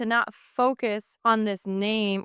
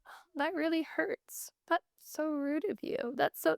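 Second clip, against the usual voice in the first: higher, softer, absurdly polite reaction; mock polite; 5 semitones higher, 9 dB quieter than usual. Stab victim mockingly polite to attacker, soft and higher. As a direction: play a stabbed person politely complaining. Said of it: "nobody gets stabbed and goes".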